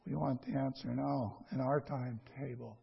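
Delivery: normal talking voice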